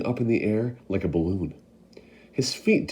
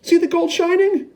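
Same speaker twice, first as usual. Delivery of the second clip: much higher, louder excited call; shouting; 8 dB louder than usual. Acted. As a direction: shout to the crowd. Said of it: "someone else shouted".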